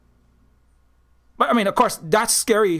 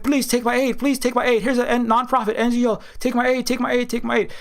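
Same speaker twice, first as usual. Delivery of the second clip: higher, pushy pleading mock voice; hammed-up pleading, pitched up; mock begging donor; 6 semitones higher than usual. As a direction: beg them to take it, over and over. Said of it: "Americans like".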